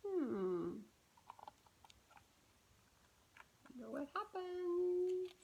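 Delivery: hushed, curious wondering; soft musing. Shown as a demonstration. Reader building suspense for the child. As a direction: muse quietly to build suspense.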